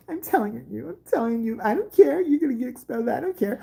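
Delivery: high, insistent mock tattletale; tattling kid. Kid heading to the principal's office, high mocking tattle.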